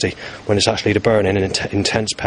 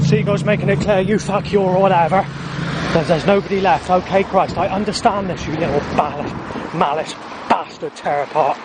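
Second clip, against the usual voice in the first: angry rant; 7 semitones higher than usual. Real, not acted. Raging at the creator god.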